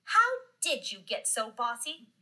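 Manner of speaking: put-on sassy storybook kid answering a bully. A narrator's reading. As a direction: talk back with sass. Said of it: "Just as sassy as could be".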